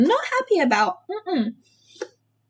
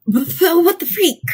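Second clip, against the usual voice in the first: ordinary delivery, nothing marked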